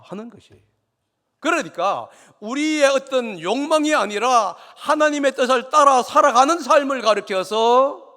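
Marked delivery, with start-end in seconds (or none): 1.4-8.1 loud, high-pitched pulpit declamation; forceful preaching. Real, not acted. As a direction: drive the point home to the congregation at full voice.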